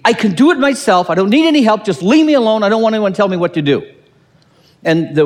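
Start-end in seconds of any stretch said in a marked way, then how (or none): 0.0-3.8 higher, louder, defiant loner voice; a know-it-all brushing everyone off, the last line rushed; mock self-reliant protest; stubborn loner. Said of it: "It's the".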